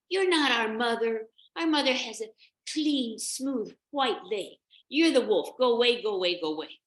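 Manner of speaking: put-on high, loud indignant children; defiant little goats in full cry; kids shouting. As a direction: yell the wolf away. Kids reject the wolf.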